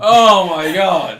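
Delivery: high, loud, drawn-out disbelief; scandalised exclamation. Real, not acted. Reacting to the co-host's accidental gaffe, scandalised exclamation. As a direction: howl it in delighted shock.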